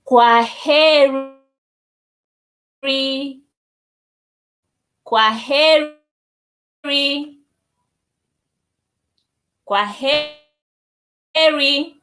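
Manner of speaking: Kwaheri said slowly, last one very drawn out rising; slow goodbye demo. Shown as a demonstration. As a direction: teach kwaheri syllable by syllable. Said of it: "It is".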